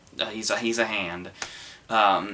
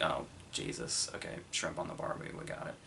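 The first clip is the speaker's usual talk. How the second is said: weary muttered aside; 4 semitones lower, 14 dB quieter than usual. Retold or imitated. Voicing his own weary inner thought.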